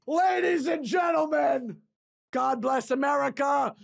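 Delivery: slow, shouted stadium announcement; mock announcer. Closing the bit as a patriotic announcer, slow booming shout. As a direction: boom it like a racetrack announcer.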